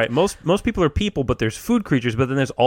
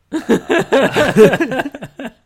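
laughing; 10 semitones higher, 7 dB louder than usual. Real, not acted. Laughing burst.

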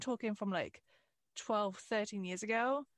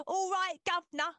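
hammed-up cockney, higher than usual; 8 semitones higher, 3 dB louder than usual. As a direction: do an over-the-top London cabbie. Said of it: "like, stereotype".

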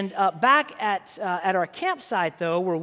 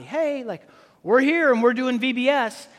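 cheerful announcement; 4 semitones higher, 5 dB louder than usual. Retold or imitated. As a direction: imitate an upbeat surprise greeting, louder and higher. Acting out surprise arrival at campsite.

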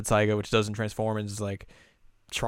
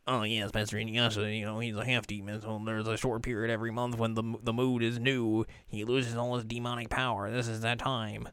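softer, humble explaining; soft servant; 7 dB quieter than usual. Retold or imitated. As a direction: murmur it deferentially. Voicing servant explaining softly.

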